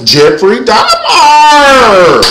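high drawn-out holler; mock-horror shout. Comic naming people she fears, drawn-out mock-horror shout. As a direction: belt out the name, stretching it long.

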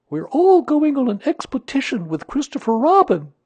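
very high, loud bear voice; high-pitched excited bear; eager bear with news. Acted.

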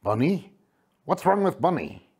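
a touch of worry in a character voice; nervous asking. A narrator's reading. Waku asking after Bunny, mildly nervous. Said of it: "asked a nervous Waku".

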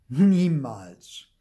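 loud, emphatic no; firm denial. A narrator's reading. Mother rejecting Theo's accusation, loud and emphatic. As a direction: cut it off firmly. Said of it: "sagt Mama".

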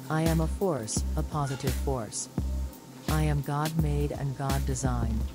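normal talking voice

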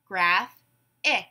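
"Graph-ic" in two separated beats; syllable by syllable. Shown as a demonstration. Class breaks the word apart together, syllable by syllable. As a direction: say "Graph-ic" as graph, pause, ic. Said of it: "break it apart into syllables".

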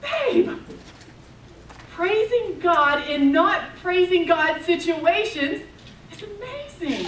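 very high, loud, slow whoa; amazed praise. Retold or imitated. Wife praising husband's faith, amazed, very high and loud. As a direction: marvel out loud. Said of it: "I said".

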